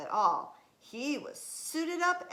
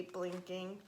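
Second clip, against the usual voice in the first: ordinary delivery, nothing marked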